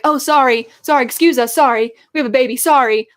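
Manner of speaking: hammed-up sorry-sorry as they squeeze past; mock apologetic parent.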